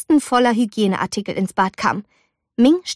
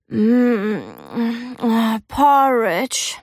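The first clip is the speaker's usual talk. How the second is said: slow, savoring mmm; dreamy delight; much slower than usual. A narrator's reading. Sleepy girl smells breakfast, slow dreamy delight. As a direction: drift toward the smell of porridge.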